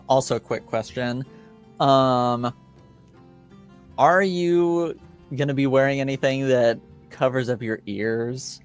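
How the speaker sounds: normal talking voice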